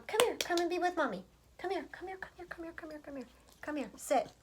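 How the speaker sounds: hushed high coaxing to a pet; soothing the dog. Real, not acted. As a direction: coo him over gently like a mother.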